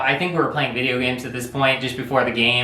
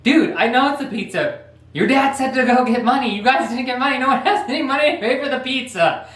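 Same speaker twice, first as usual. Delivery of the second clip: higher, annoyed rant; exasperated friend; 9 semitones higher than usual. Retold or imitated. Friend complaining to the brother about the money, higher pitch. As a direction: vent that nobody has money. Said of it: "he's like".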